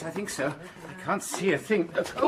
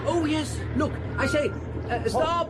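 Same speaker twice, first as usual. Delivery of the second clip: calling out to a car; 10 semitones higher, slower than usual. Acted. Hailing a passing motor car.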